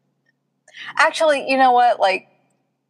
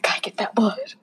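breathy vampire whisper; 6 dB quieter than usual. Retold or imitated. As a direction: whisper it hungrily. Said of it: "he was still like".

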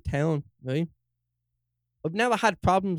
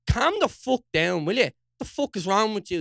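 angry plea; 7 semitones higher, 4 dB louder than usual. Retold or imitated. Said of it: "I'm like".

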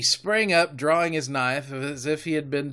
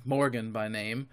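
plain reading voice

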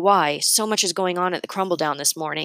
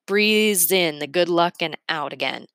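ordinary delivery

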